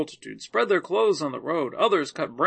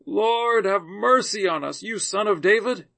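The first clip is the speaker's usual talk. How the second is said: raised, louder pleading call, mildly acted; crying out; 6 semitones higher, 5 dB louder than usual. A narrator's reading. Two blind men calling to Jesus as he passes, crying out. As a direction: call out higher and louder, in a narrator's measured way. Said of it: "cried out".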